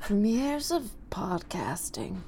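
put-on slow, whispery old voice; very old faint voice. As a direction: breathe it out like a frail elder. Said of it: "diminished lung capacity".

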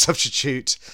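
ordinary talk, nothing marked

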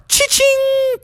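high, ringing cha-ching; cha-ching sound effect. A narrator's reading. Storyteller marking each landed throw, ringing. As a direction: imitate a ringing cash register.

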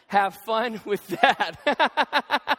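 very slow, laughing through the words. Retold or imitated. Reading a spiteful office note to the church, laughing. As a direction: savor the spite and break into laughter.

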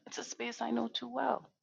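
a soft breathy whisper after a small laugh. Real, not acted. Echoing her own line to listeners in a hushed whisper. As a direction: lean in and breathe the words privately.

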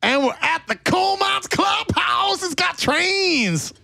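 very high, drawn-out holler; calling out. Pretend. Announcing the clubhouse trains, high stretched shout. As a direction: holler it slowly, stretching the last word.

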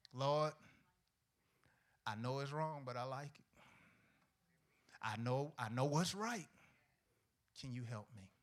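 quiet mock prayer. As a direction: hush it like a sheepish sinner's prayer.

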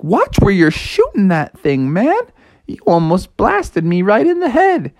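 rattled, high-pitched telling-off; indignant protest. Acted. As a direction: scold him for the near miss. Bucky scolds after near miss.

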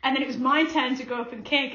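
ordinary talk, nothing marked

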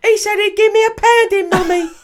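put-on a Cockney little boy telling tales to his mum; Cockney child voice to his mummy; annoying Cockney child. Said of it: "annoying Cockney child accent".

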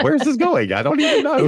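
high, bewildered squeal; baffled. Retold or imitated. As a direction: sound totally thrown. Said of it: "I was like".

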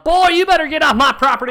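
high, loud hammed-up holler; hostile yell. Old-timer to a stranger on his property, hostile yell. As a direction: yell a stranger off your land. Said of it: "That yelled".

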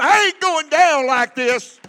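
full-force preacher's shout; defiant church holler; rallying shout. Shown as a demonstration. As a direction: belt it out for the congregation to echo. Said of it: "Somebody needs to shout".

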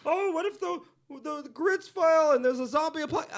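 high, whiny mock worrier; put-on high panicky whine; mocked doomsayers. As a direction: imitate people fretting in a high voice. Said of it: "they're like".

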